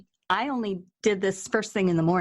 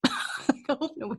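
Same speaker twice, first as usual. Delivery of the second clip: embarrassed laugh; 4 semitones higher, 5 dB quieter than usual. Real, not acted. Laughing at herself alone.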